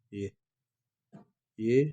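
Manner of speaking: ordinary talk, nothing marked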